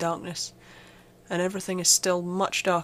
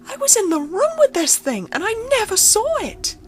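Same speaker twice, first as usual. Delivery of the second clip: much higher and louder, frantic, building to near-shouting; panicked; 7 semitones higher, 3 dB louder than usual. Acted. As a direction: build from shaky disbelief to full panic. Realises it was there all along.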